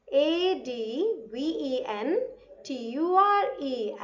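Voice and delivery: usual speaking voice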